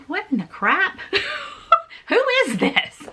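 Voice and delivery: high, startled laugh of disbelief; bewildered, laughing at the shock; baffled inner thought. Retold or imitated. Thinking about the stranger at her door, startled and baffled. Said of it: "And I thought".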